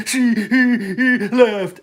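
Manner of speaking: high, loud words broken up by laughter; can't get the punchline out for laughing; cracking up mid-sentence; laughing through the quote. Retold or imitated. Laughing while quoting friend's joke.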